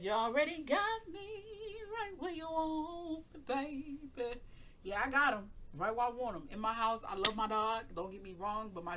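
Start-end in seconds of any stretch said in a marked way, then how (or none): 0.0-4.4 high, slow teasing croon; sultry dog voice. Retold or imitated. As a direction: coo it like a lover.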